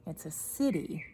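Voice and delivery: usual speaking voice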